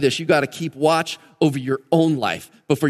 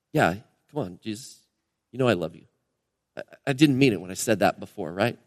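lower, softer, sheepish reply; sheepish Peter; 6 semitones lower, 5 dB quieter than usual. Retold or imitated. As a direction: sound caught out and a little embarrassed. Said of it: "It's like, sheepishly".

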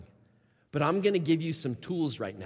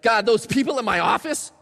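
higher, louder fed-up cry to God; mock exasperation, raised and slowed; exasperated complaint; 7 semitones higher, 6 dB louder than usual. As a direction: vent about the office and the kids. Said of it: "You might write it".

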